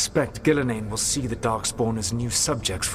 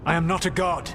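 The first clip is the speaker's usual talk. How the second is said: indignant denial; 7 semitones higher than usual. Acted. Mage rejects being called a god. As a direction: throw the accusation back. Heated argument with Rook.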